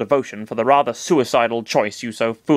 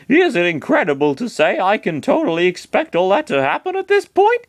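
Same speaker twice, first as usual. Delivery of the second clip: higher, rising disbelief; Chris's voice; 9 semitones higher than usual. Acted. Chris tells Master Hand he expects the worst, rising in disbelief. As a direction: climb higher toward the end in disbelief.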